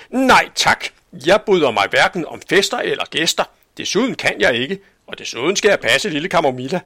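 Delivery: high, loud prim spinster refusing flatly; stern Aunt Sofie. Acted. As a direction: snap the refusal like a strict old maid. Tante Sofie afviser skarpt. (streng, høj og skarp tante Sofie)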